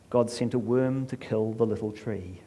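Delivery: ordinary delivery, nothing marked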